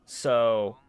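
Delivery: usual speaking voice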